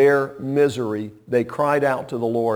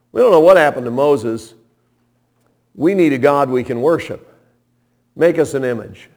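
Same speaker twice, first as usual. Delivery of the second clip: louder, insistent crowd demand; 3 semitones higher, 7 dB louder than usual. Retold or imitated. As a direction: voice the restless people demanding. People demanding an idol from Aaron.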